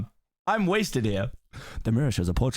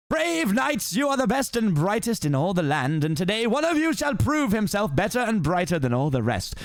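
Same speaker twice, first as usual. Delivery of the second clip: lightly voiced pompous lord; lord's speech; 7 semitones higher than usual. Farquaad addressing the knights, lightly voiced pompous speech.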